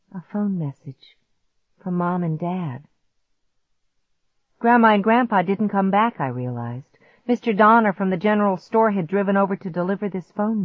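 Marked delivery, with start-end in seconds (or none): none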